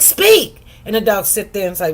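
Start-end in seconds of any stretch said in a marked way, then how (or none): 0.0-0.6 a much higher, louder sharp command. Retold or imitated. Owner losing patience with the dog, shouting speak. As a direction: bark the order at the dog.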